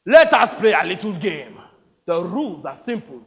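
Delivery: theatrical threat with loud bursts; menacing warlord. Acted. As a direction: set out a deadly game with relish. Plays the warlord.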